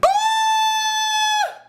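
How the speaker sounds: shrill, very high woman-like shriek; high-pitched scream. Retold or imitated. Snake on his foot, screaming like a woman. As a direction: let out a piercing falsetto scream. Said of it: "I sounded like a woman".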